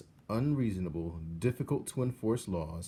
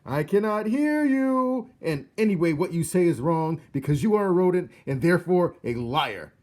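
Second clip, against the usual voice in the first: put-on drown out the mouse like a sulking child; shouting; 10 semitones higher, 12 dB louder than usual. A narrator's reading. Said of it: "he stopped to shout".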